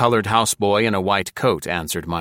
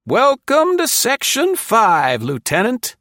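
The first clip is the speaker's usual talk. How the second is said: very high, slow, controlled courteous man's voice; courteous neighing voice; 5 dB louder than usual. A narrator's reading. Colonel greets the new lieutenant, courteous and high. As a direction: greet him like a smooth politician. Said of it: "controlled and courteous as a politician's".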